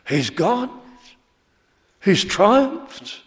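usual speaking voice

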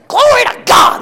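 high, loud cry of glory; shouted praise. Retold or imitated. Preacher retelling his reply to the woman, shouted praise. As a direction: burst out with joy.

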